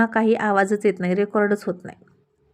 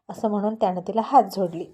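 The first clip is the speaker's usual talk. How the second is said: usual speaking voice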